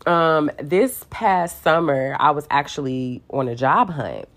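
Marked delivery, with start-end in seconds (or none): 0.0-0.1 lightly mocking sassy complaint; retold sassy gripe trailing into da-da-da; mad and sassy. Woman griping at God over delays, mad and sassy. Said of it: "downright just mad and sassy with him".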